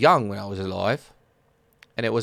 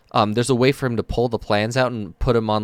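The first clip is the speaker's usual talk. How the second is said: ordinary talk, nothing marked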